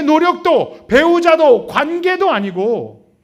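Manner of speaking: loud, slow, emphatic preaching as God's call; thundering preaching. Acted. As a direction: proclaim each word like a summons. (크고 천천히 힘주어 외치는 설교)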